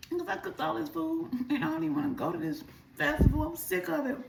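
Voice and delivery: high, teary whine, imitating her crying self; retold crying. Telling her cousin why she won't go, high teary whine. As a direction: whimper out the complaint like the tears are back. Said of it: "I'm crying".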